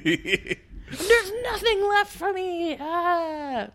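high, hammed-up whimper; mock despairing wail. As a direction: wail it like a melodramatic teen. Mocking whiny despair.

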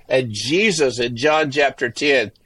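usual speaking voice

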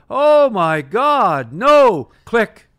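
a pilot shrieking into the intercom as if the plane is going down; panicked scream. Retold or imitated.

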